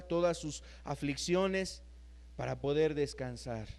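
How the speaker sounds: tender, hushed sermon voice; gentle preaching. Acted. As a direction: draw the tired listener in gently. Closing soft invitation.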